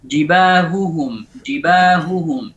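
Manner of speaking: jibahuhum slowly, both ha sounded; slow demo; clear double ha. Shown as a demonstration. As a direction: separate the two ha in jibahuhum. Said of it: "harus dijelaskan".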